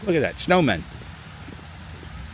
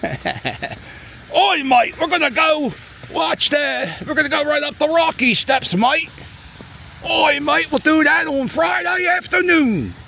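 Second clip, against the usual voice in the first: bad, high-pitched mock Australian accent; joking Australian accent, badly done; Australian impression; 13 semitones higher than usual. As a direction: ham up an Aussie tourist, Australian twang all through. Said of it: "My Australian impression stinks".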